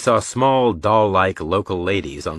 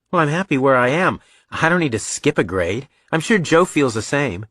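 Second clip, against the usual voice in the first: put-on higher-pitched, insistent young son; protesting boy; 6 semitones higher, 3 dB louder than usual. Son objecting to a school test, protesting. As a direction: argue your case as a boy.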